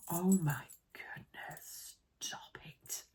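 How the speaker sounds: gasping, breathless whisper of delight; awed whisper. Real, not acted. Unboxing pink clasps, she whispers in awed delight. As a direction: whisper it as if you can't believe your eyes.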